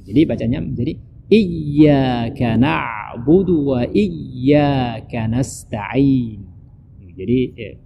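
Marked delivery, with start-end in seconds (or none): 1.3-6.4 slow chanted "nasta'in" with a throaty ain; ain from throat. Shown as a demonstration. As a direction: keep the ain of "na'budu" out of the nose. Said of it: "tidak boleh masuk ke hidung".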